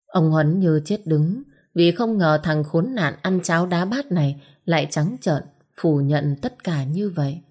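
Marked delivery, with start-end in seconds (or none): none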